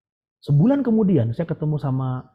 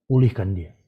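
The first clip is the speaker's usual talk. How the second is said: voice of God; 7 semitones lower than usual. Retold or imitated. God answering the preacher about the woman, low imitated command. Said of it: "Tuhan bilang".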